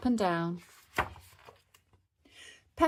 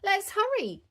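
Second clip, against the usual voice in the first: put-on high-pitched hurrying child; eager little girl's plea; calling; 14 semitones higher than usual. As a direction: urge Daddy on, high and keen. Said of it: "called Peppa".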